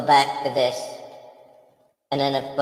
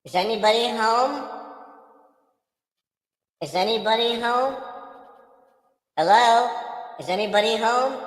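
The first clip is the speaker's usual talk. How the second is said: higher ghostly call into empty house; lightly voiced calling ghost; calling down a hallway; 7 semitones higher than usual.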